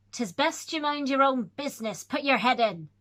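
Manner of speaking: loud gruff warning. Acted. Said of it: "he said it so hoarse and faint".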